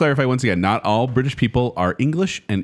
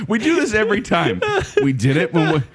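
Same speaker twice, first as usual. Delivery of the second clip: laughing; 6 semitones higher than usual. Real, not acted. Laughing while speaking.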